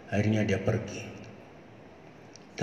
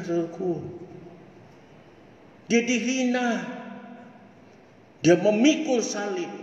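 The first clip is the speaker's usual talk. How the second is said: raised, ringing pulpit voice; fervent preaching; 10 semitones higher than usual. Acted. Preacher urging his brothers and sisters, fervent preaching. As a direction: preach it out to the congregation with rising fire.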